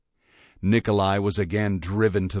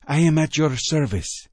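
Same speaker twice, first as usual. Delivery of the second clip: devoted servant; 7 semitones higher, 3 dB louder than usual. A narrator's reading. Nikolai vows service.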